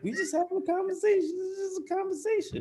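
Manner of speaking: mock innocent. Shown as a demonstration. New Yorker insisting it's only a conversation, high and innocent. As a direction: squeak it out like it's obviously harmless. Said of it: "people think we angry".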